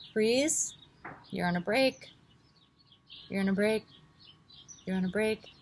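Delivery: calm breath-by-breath guidance; guided meditation. Acted. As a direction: talk them through each breath.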